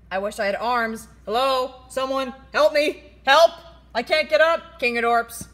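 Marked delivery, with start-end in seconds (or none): 1.3-4.8 loud distressed cries; louder helpless cry; calling for help. Acted. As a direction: call out for help from the floor. Fallen kaiju cries for help.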